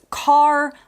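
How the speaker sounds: "car" drawn out with a clear final R; slow "car" with a strong American R. Shown as a demonstration. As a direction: sound the R fully at the end of "car". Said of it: "clear, strong R sound".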